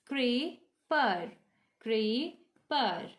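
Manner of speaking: CREEPER broken in two, cree-per, with a pause between; split into syllables. Shown as a demonstration. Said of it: "we have two syllables".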